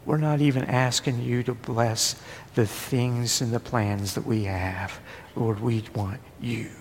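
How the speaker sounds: soft, almost whispered plea; hushed prayer. Acted. Preacher leading the congregation in prayer, hushed whisper. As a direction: let it fall to a whisper.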